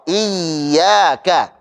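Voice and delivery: "Iyyaka" with the doubled ya pressed but flowing; ya ditekan mengalir. Shown as a demonstration. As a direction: let the sound run on through the ya of "Iyyaka". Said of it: "tapi sambil dialirkan suaranya". ("Iyyaka", ya ditekan sambil suaranya dialirkan)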